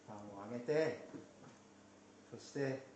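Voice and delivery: usual speaking voice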